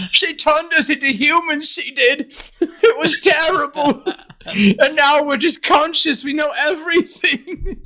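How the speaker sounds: high voice, laughing through it; laughing ram-man. Retold or imitated. The ram-man complaining about Stella's magic, high and laughing. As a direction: lament it with a giggle. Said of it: "Could you imagine him".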